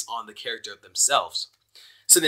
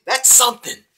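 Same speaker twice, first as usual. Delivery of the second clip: sarcastic remark; 9 semitones higher, 4 dB louder than usual. Real, not acted. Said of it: "sarcastic repeat of comment".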